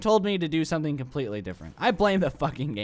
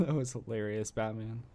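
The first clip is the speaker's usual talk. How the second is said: laughing; 3 semitones lower, 8 dB quieter than usual. Real, not acted. Laughing at Batman's move.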